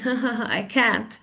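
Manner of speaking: quick, unamused fake "ha ha ha"; sarcastic. Shown as a demonstration. Teacher shows students mock amusement, sarcastic. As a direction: fake the laugh without any pitch lift. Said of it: "you know I'm being sarcastic".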